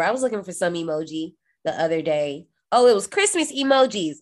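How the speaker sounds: ordinary talk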